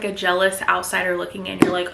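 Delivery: ordinary delivery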